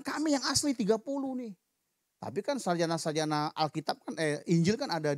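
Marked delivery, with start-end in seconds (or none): 0.0-1.5 boastful claim. Retold or imitated. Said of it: "Mereka bilang".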